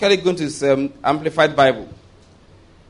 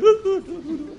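high-pitched giggling ukutututu; high laugh. Real, not acted. Preacher laughs at his donkey story, high giggle. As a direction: giggle at your own joke.

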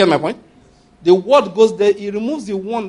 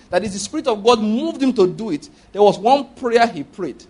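ordinary talk

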